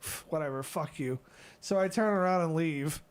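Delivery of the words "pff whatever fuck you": muttered brush-off. Retold or imitated. Muttering dismissal at guard.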